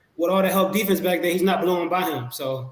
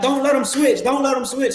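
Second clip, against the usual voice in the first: higher-pitched, mildly raised mock yell; retold yell; 8 semitones higher than usual. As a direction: re-enact yelling at the TV, pitched up without full volume. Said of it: "I'm yelling at the T V".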